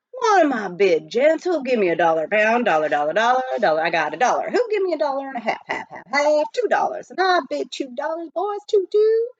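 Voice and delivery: loud, high, rapid auction chant; auctioneer's patter. A narrator's reading. The Cheap John drumming up bids from miners, fast auctioneer chant. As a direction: rattle off bids like a frontier auctioneer.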